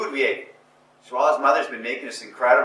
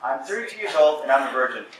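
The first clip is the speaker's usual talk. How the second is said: louder and higher, laughing as it starts; proud loud declaration; 3 semitones higher, 5 dB louder than usual. Retold or imitated. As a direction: announce it boldly, half laughing. Bold confession to a date.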